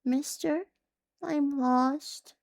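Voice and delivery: put-on high small lost child's voice; trembling child. A phantom child calling from a puddle, high and trembling. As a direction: quaver it like a scared little kid. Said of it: "A child's voice trembled out of a puddle".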